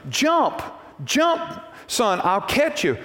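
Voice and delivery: high mock call of a father to his son on the roof. Father below a burning house calls his son to jump, high urgent call. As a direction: call up to the boy, urging him to jump. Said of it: "he starts telling his son".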